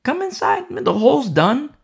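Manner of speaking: Noah calling out, high and light; a hint of Noah calling from the door; playful Noah calling people in; mild mock shout. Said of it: "shouting to the people".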